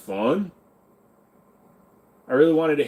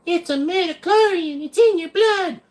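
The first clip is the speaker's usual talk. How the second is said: much higher, sarcastic squeal; mock the lore in a squeaky voice; 4 dB louder than usual. Streamer mocks midichlorians in a high squeal.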